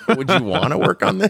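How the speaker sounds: high, laughing ask. Retold or imitated. Quoting the offer to direct, laughing. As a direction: laugh through the invitation.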